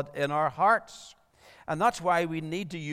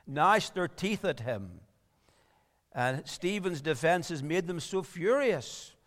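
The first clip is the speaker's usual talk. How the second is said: ordinary delivery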